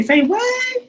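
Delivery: shrill disbelieving say what; high, startled disbelief; surprised. Retold or imitated. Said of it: "I say".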